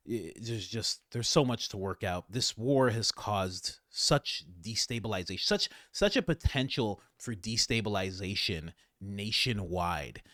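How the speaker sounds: ordinary delivery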